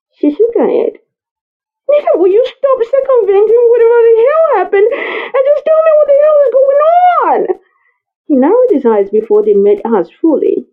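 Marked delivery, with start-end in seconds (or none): 1.9-7.6 high, fast tearful female voice; desperate woman. Acted. Nita demanding the truth from the man, tearful high voice. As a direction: push her words out, on the edge of tears. Woman pleading, near tears.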